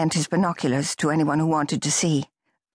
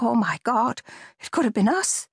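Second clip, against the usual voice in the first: shocked; 8 semitones higher than usual. Acted. Girl in shock.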